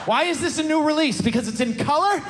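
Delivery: shouting through laughter; ranting while cracking up; laughing rant. Real, not acted. Laughing rant about new releases.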